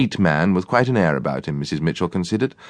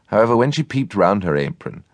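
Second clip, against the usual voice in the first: plain reading voice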